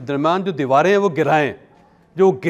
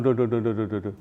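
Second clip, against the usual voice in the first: Chaudhry Shujaat mumble; 5 semitones lower, 6 dB quieter than usual. Retold or imitated. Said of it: "Chaudhary Shujaat बन गए आप यार".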